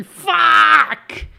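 loud, high pained shout; stubbed toe yell. Pretend. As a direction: yell it like you just stubbed your toe. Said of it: "I think it'd be".

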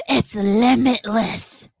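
slow breathless dread; stunned dread. Retold or imitated. Selner telling the agents his power is limitless, slow dread. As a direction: let it fall out in slow horror. Healer voiced in shock.

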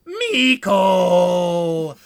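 loud, long-held mock call; Nuki. Nuki calls to brother Miko, mock wailing call. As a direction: stretch the name into a hammed-up cry. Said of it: "And then Nuki goes".

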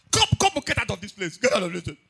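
very high loud cry to get out; shouting at him. Retold or imitated. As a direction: chase him out with your voice. Shouting at the man to leave.

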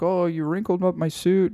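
put-on old man complaining. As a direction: whine it up high like a hurt grandpa. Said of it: "he's like".

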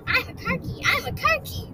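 high-pitched panicked squeal; freaked-out rat. Acted. Freaked Rat blurting nonsense, high panicked squeal. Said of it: "Rat was so freaked".

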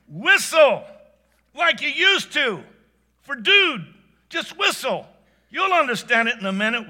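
high, loud and eager, pressing him; urgent urging. Acted. Wife urging her husband to whistle for the horse, eager loud urging. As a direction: push him to do it, excited.

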